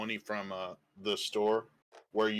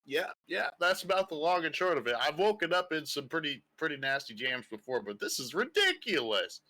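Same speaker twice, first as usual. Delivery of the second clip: higher voice, wry and weary; half-orc monk grumbling about the cage, higher voice; 6 semitones higher than usual. Acted. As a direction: play it like a seasoned drunk brawler.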